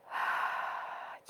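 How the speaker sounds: long, breathy exhale; long sigh. Real, not acted. Teacher settling into meditation, long releasing sigh. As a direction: let the breath all out in a sigh. Deep breath before closing eyes.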